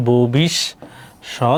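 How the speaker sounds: "ভবিষ্যৎবাণী" very slow, in separate chunks: bho-bish, shot, bani; syllable by syllable. Shown as a demonstration. Teacher confirming it for students, "ভবিষ্যৎবাণী" slowly syllable by syllable. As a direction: break "ভবিষ্যৎবাণী" into slow, firm parts with pauses. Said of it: "তাহলে কি?".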